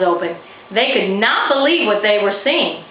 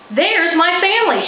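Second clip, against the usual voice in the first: yelled; 10 semitones higher than usual. A narrator's reading. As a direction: call out to distant family. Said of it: "Winter yelled".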